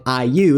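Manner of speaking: iu as a falling glide, like English ew; falling diphthong iu. Shown as a demonstration. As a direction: say "iu" close to English "ew". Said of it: "is pronounced as iu, something like the English ew".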